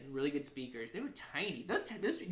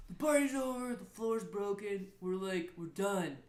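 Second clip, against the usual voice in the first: raised, higher-pitched party-ending announcement; imitated announcement; 5 semitones higher than usual. Roommate shutting down a party, imitated announcement. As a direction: call out as if to a crowd.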